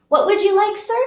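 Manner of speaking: a high, lightly put-on waiter voice; server taking order. Server asking Amir for his order: light high put-on voice. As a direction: ask sweetly like a pretend waitress.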